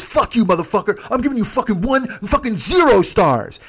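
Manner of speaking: high, loud imitation peaking on zero; retold furious parting shot. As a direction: curse as the irate passenger, building up. Passenger leans in cursing, retold.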